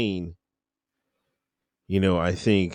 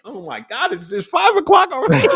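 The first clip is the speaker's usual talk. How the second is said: high, louder jolt of disbelief; shocked disbelief; 5 dB louder than usual. Retold or imitated. Recruit woken at five by the lights, high shocked yelp. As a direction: yelp like you were just jolted awake.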